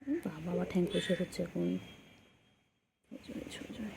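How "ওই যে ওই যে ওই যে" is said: whisper. Real, not acted. Whispering while watching the pet.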